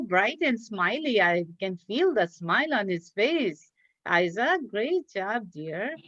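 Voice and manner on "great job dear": ordinary delivery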